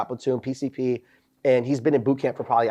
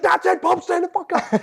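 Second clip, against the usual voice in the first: drill instructor outburst. Retold or imitated. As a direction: explode at the recruit. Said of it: "He's like".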